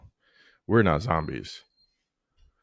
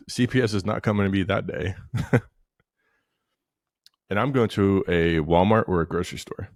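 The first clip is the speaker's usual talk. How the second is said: ordinary talk, nothing marked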